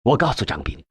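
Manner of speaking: ordinary delivery, nothing marked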